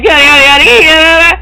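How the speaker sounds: loud cat meowing; cat call. Retold or imitated. Girl doing her own mating call again, loud cat meows. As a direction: meow at the camera.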